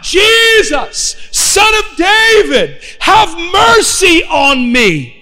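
high, long-drawn calling out; imitated shout. Blind man calling to passing Jesus, imitated shouting. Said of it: "he began to shout out".

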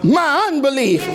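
father crying out for help. Acted. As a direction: beg the Lord with tears. Preacher voices the pleading father.

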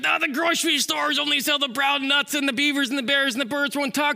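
high, aggrieved whine; complaining squirrel. Acted. Squirrel tells God what's wrong, high complaining.

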